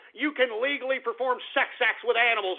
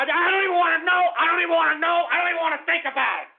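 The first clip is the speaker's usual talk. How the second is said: fast, much higher and louder disgust; exasperated outburst; 7 semitones higher, 4 dB louder, much faster than usual. Real, not acted. Host recoils from horse jokes, fast exasperated yell. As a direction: throw up your hands and yell it.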